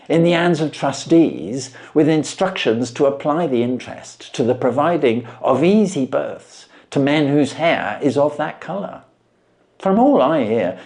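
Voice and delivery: measured storytelling voice for a classic tale; calm, steady reading of an old detective story; story narration. A narrator's reading. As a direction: read it like an audiobook narrator. Reads a Sherlock Holmes story aloud.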